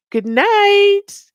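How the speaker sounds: loud, high, stretched farewell; calling out. Real, not acted. Host bids a viewer good night, calling out. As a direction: sing out a big goodbye.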